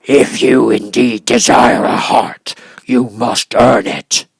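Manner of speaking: slow, loud gruff growl; gruff beast. Acted. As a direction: growl it like a grudging monster. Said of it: "but said gruffly".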